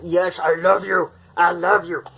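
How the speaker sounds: affectionate pet voice; cooing at the cat on her lap. Real, not acted.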